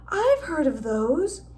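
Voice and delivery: plain reading voice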